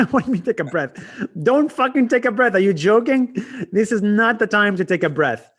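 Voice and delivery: loud, disbelieving push-back; incredulous outburst. Real, not acted. Outraged at advice to relax.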